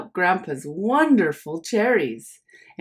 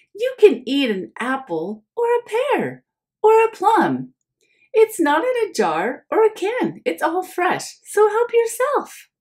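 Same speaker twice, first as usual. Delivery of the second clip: high, louder motherly voice; proud mom; 11 semitones higher, 3 dB louder than usual. Acted. Mom showing Oliver the fresh fruit, high and pleased. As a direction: offer it all up proudly.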